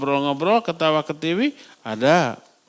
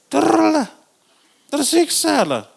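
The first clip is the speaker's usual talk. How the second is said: emphatic answer; 11 semitones higher than usual. Acted. Preacher answering his own question for the congregation, emphatic and high. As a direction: throw the answer back at the crowd.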